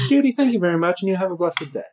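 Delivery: sweet Southern twang; polite Southern accent. Acted. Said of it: "I don't know why we're Southern".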